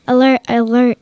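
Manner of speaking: yelled alarm. A narrator's reading. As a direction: sound the alarm to the others. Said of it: "yelled Lissa".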